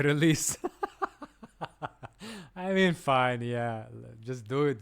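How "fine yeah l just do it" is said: normal talking voice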